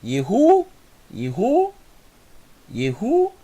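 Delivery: "Yehu" with the Ye clipped very short; teacher modelling "Yehu", rushing the first syllable; quick Ye sound. Shown as a demonstration. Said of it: "veja que eu li bem rápido o I". (professora mostrando "Yehu" com o Ye bem rápido)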